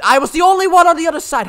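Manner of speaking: loud, frustrated protest; exasperated yell. Real, not acted. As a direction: yell in disbelief at teammate. Frustrated outburst while gaming.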